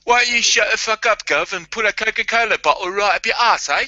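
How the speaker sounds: high-pitched, hammed-up Cockney geezer; Cockney accent. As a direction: put on a cheeky Cockney telling-off. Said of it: "I'd love to have a Cockney accent".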